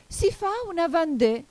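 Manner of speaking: high, loud dance call; excited interruption. A narrator's reading. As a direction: cut in eagerly with the next step. Excited griffin interrupts with dance steps.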